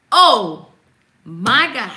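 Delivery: slow, emphatic, each word set apart; reverent awe. Shown as a demonstration. Showing how we should say it, slow and emphatic. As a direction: proclaim it with a pause after OH. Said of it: "That's how we should say it".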